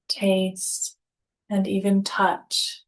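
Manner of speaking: ordinary delivery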